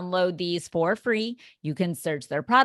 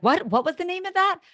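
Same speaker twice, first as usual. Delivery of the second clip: high, far-off puzzled voice; confused question; 13 semitones higher, faster than usual. Retold or imitated. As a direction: ask it sounding lost and confused. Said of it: "they're like".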